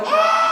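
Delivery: very high, loud shriek; girlish scream. Retold or imitated. Man grabbed by the ankle on the way to bathroom, girlish scream. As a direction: scream like a frightened girl. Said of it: "I screamed like a girl".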